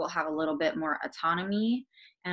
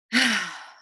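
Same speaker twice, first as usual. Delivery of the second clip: loud open-mouthed sigh, falling away; audible exhale; 2 semitones higher, 5 dB louder than usual. Shown as a demonstration. Coach showing listeners how to release tension, audible exhale. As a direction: let the breath out audibly through the mouth. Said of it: "breathe audibly out your mouth".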